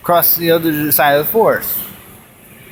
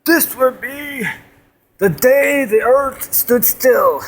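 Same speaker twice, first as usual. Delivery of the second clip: trailer voice; 6 semitones higher, slower than usual. Acted. Dramatic movie trailer line.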